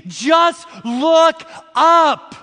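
stretched out and called loud, each word weighted; slow loud exhortation. Acted. Preacher calls out to the congregation, slow and loud. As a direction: lift the room with a slow, loud plea.

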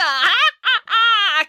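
high evil witch cackle. A narrator's reading. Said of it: "cackled the witches".

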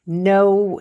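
ordinary delivery

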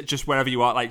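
normal talking voice

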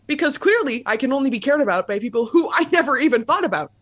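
usual speaking voice

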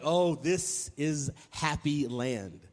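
slow, acted sigh of contentment; dreamy and unhurried; blissful savoring. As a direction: draw it out, savoring each word. Heart's blissful reaction, acted.

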